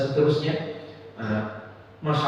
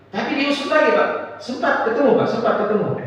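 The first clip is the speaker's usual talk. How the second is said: eager prying; 6 semitones higher than usual. Retold or imitated. Seatmate pressing eagerly on plane.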